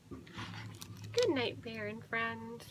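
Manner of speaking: very soft, half-whispered farewell; tender bedtime murmur; hushed good night. Pretend. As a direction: whisper so the sleepers don't wake. Whispered good night to sleeping animals.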